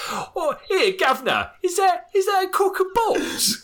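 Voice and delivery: put-on high, laughing cockney accent. A cockney newbie exclaims to the room in a cockney accent. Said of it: "with his really cockney accent".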